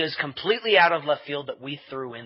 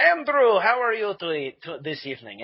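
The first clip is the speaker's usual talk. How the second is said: put-on Russian accent; 4 semitones higher than usual. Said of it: "I will talk to them in a Russian accent".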